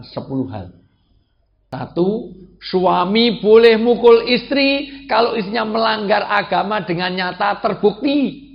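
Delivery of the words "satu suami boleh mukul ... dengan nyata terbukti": loud, high, measured proclamation; full-force preaching. Real, not acted. Preacher lays down the first condition, loud emphatic preaching.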